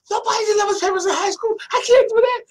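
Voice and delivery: very high, loud, squealed imitation of objecting; a put-on squeal of youthful protest; mock outraged protest. Son objects to mother's paper-route order, mock outraged squeal.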